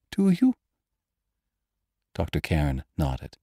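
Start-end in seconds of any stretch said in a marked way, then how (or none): 0.1-0.5 high, anxious woman's voice; breathed question. A narrator's reading. Frightened woman questioning her doctor, high and breathed. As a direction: ask it high and fearfully. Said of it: "she breathed".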